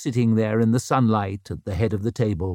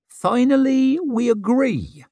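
much higher, drawn-out exclamation; triumphant agreement; 14 semitones higher, 4 dB louder, slower than usual. A narrator's reading. Isern agreeing with Brock at last, triumphant. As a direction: crow it, high and slow.

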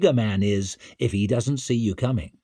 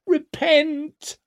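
very high-pitched fanatic screech. A narrator's reading. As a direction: screech it like a frenzied street prophet. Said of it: "she was screeching".

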